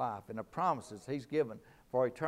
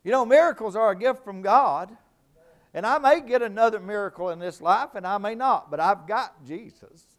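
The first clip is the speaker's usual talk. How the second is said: loud, high, driving preacher's cry; full-force preaching; 7 semitones higher, 13 dB louder than usual. Acted. Preacher rousing the congregation, shouting high and loud.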